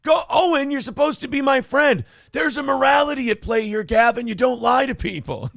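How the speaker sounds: high, loud mock protest; mock whine. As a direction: plead with Owen in a hammed-up high voice. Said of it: "And it's like".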